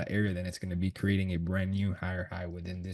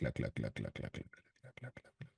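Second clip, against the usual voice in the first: fading away; 8 semitones lower, 13 dB quieter than usual. Real, not acted. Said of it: "repeating look fading away".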